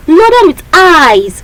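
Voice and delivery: reading the poem aloud. Shown as a demonstration.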